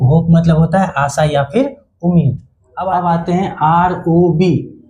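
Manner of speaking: usual speaking voice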